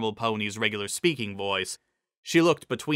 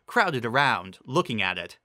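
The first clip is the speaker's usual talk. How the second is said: ordinary delivery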